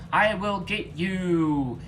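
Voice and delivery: slow, loud, high battle threat in a put-on voice; menacing threat. A narrator's reading. Garfield charges the husks, slow loud threat. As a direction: drag each word out as you charge. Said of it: "said Garfield as he charged".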